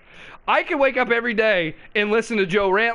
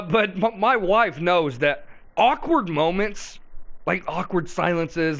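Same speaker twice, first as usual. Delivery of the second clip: usual speaking voice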